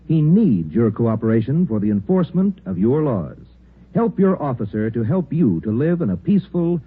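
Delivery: normal talking voice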